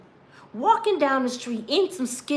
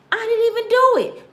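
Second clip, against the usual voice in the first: high, louder cry of innocence; desperate protest; 9 semitones higher, 4 dB louder than usual. Retold or imitated. A defendant protesting innocence, high and desperate. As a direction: plead it up high and pressed. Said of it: "And you sitting there like".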